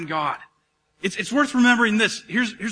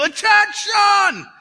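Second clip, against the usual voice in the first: drawn-out high shout; yelling; 13 semitones higher, 7 dB louder, much slower than usual. Retold or imitated. God yelling at Ezekiel, slow high shout. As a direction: bellow it like a drill sergeant. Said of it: "God was yelling at Ezekiel".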